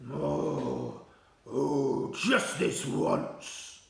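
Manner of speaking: low, very slow grudging giant; Blood Butler voice. Acted. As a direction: give in with a grumbling drawl.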